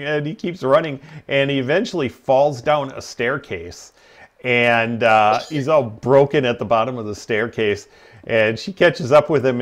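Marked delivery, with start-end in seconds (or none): none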